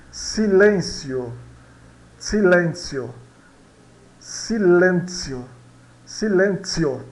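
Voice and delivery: "Silêncio" bem lento e articulado; pronúncia espanhola lenta. Shown as a demonstration. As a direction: alongue cada sílaba de "Silêncio". ("Silêncio" devagar, sílaba por sílaba)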